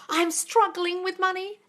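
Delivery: slow, high mimicry of a complaint; mocking parroting. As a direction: parrot it back with MONEY drawn out. Parrots the viewer's words back.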